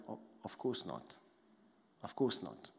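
low, soft reassurance; reassuring Father. Acted. God the Father answering his child, gently reassuring. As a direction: gently put the doubt to rest. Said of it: "the Father's gonna go like".

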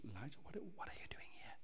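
breathy hush, God speaking softly to Elijah; barely voiced whisper, gentle and near; whispered still small voice. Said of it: "speaks in the still small voice".

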